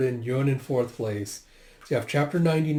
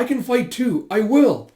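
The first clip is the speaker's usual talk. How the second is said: high, loud protest; defiant insistence; 9 semitones higher, 7 dB louder than usual. Retold or imitated. Insisting she can fight beside him, high and defiant. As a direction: insist hotly.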